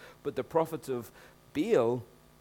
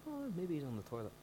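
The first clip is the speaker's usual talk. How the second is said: mocking; 5 semitones higher, 8 dB quieter, faster than usual. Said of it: "he's going".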